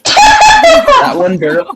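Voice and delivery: high laughing shout. Real, not acted. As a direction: laugh it out loud. Laughing at the sound.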